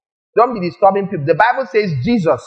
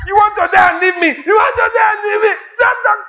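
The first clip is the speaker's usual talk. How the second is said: a panicked wife begging her husband; pleading wife. Retold or imitated. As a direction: shriek at him not to get them killed.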